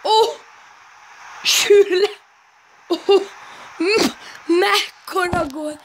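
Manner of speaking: high, thrilled goal cheer; excited hungarian sports commentary. Real, not acted. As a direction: shout the goal out in joy.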